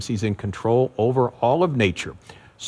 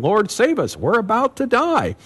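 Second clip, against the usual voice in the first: mock panic; 11 semitones higher than usual. Disciples waking Jesus in the storm, acted panicked plea. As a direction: plead urgently as if about to drown.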